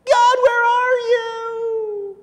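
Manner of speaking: a stretched, high plea for God; desperate cry to God. Retold or imitated. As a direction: wail it out like someone lost. Crying out after wandering off.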